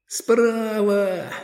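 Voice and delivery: high fan's shout; yelling from the sidelines. A narrator's reading. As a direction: call it out from the stands. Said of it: "кричали болельщики".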